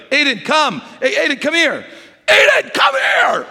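loud, high, bossy calling that builds to a yell; commanding shout. Shown as a demonstration. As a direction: bark the name like an order across the room. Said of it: "I changed my whole demeanor. Instead of, like".

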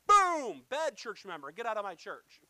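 explosive loud boom, then forceful rebuke; hammed-up punitive shout; mock scolding outburst. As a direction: burst out, then order them away loudly. Acts out striking a misbehaving member.

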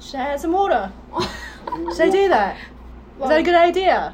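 put-on British accent, questioning. Shown as a demonstration. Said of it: "I'm doing a British".

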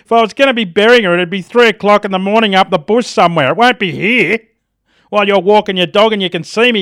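mock-furious rant at the bloke who called the cops; retold angry rant. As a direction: have a go at the nosy bloke. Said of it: "I was pretty angry with the bloke".

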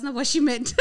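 laughing. Retold or imitated. Panicked inner thought, laughing.

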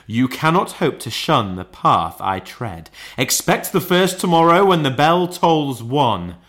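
loud, rising proclamation; warning ghost. Acted. Ghost announcing the spirits to Scrooge, loud and commanding. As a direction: pronounce it like a solemn decree.

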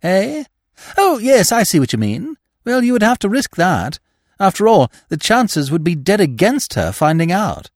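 put-on high, excitable young gentleman; breezy young persuader; eager young Freddy, dismissive. A narrator's reading.

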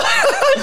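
shrill high laugh; high squeal of laughter. Real, not acted. Laughing at joke.

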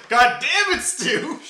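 Billy cursing at Stu in frustration; exasperated yell. Retold or imitated.

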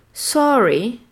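British sorry; "Sorry" with a round O; British English. Shown as a demonstration. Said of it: "Pareil pour le mot désolé". (en anglais britannique, "sorry" avec un O rond)

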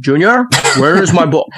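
Scottish movie-dad impression; put-on Scottish actor accent; Sean Connery. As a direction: call out as a Scottish film father. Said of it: "You always do Indiana Jones, Sean Connery".

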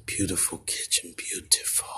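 breathless whisper of awe; awed whisper. Real, not acted. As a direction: breathe the word out, stunned by beauty. Whispered awe at dream kitchen.

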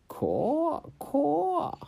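high, loud croaking caw; raven caw. A narrator's reading.

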